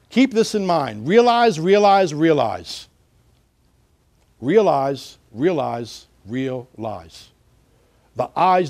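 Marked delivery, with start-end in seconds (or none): 1.1-2.9 "Realize, realize, realize" in one quick, loud run; run together quickly. Shown as a demonstration. Teacher giving a slogan to remember, said in one run. Wordplay said quickly, then spaced out. 4.4-7.3 "Realize, realize, realize" one at a time, with pauses; spaced out slowly. Shown as a demonstration. Teacher repeating a slogan for meaning, slow and separated.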